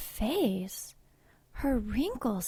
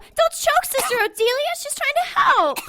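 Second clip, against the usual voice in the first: high, loud frantic shouts; panicked screaming; 12 semitones higher, 13 dB louder than usual. Acted. Nun screaming as the patient chokes her sister.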